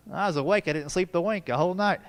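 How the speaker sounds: normal talking voice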